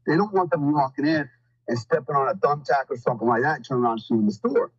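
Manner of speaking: ordinary talk, nothing marked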